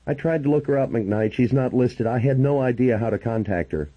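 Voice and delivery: a worried man who can't reach her; Grant, worried and pleading. Acted. Worried Grant telling the narrator he can't reach her, lightly pleading.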